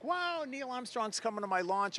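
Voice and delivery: gushing like a starstruck fan; excited. Retold or imitated. Astronaut recalling Armstrong accepting his invite, excited. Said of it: "I was so excited".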